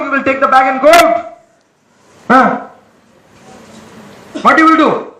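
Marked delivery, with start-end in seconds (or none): none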